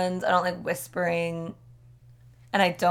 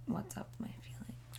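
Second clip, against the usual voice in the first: whispering; 20 dB quieter than usual. Pretend. Whispered tarot banter.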